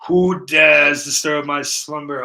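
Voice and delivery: slow booming undead king voice; ancient rasping undead king. Pretend. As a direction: rise from the tomb, slow and loud. Woken streamer greets a raid.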